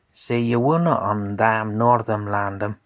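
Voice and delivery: ordinary delivery, nothing marked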